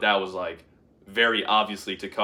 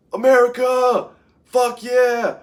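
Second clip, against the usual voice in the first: very high, loud triumphant yell; screaming cheer; 13 semitones higher, 6 dB louder, slower than usual. Retold or imitated. Yelling in the store aisle after the win, screaming cheer. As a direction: roar it down the aisle. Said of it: "I'm fucking screaming".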